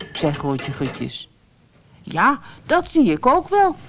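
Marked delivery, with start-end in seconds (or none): 2.0-3.8 put-on high boy's voice, calling out; a small boy calls back, much higher; child calling out. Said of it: "riep Brammetje Braam". (hoge kinderstem, roepend)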